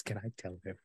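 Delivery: very soft, low aside to friends; mouthed question. Acted. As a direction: barely voice it, as if mouthing. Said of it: "and mouths".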